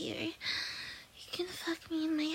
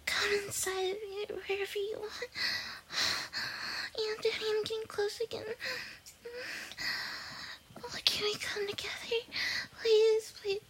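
urgent whisper, higher and louder; breathless pleading; 5 semitones higher, 5 dB louder than usual. Acted. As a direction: beg faster and faster, then count down. Building to climax, pleading, counting down.

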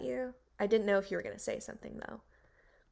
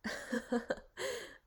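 breathy high giggle. Acted. Reaction laugh after proposition.

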